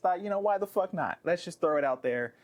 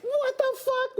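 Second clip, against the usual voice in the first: much higher, squealing disbelief; shocked; slower than usual. Retold or imitated. Host retelling reading the fan email, high shocked squeal. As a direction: react like you can't believe it. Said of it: "I checked the email and was like".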